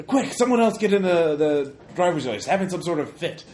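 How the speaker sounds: shouted order to the crew; urgent yell. Acted. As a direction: yell for someone to take over. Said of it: "I yell for another driver".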